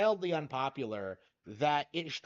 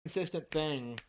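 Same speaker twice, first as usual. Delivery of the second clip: muffled, talking into hands; face in hands. Real, not acted. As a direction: speak through your hands, muffled. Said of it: "stop talking into your hands".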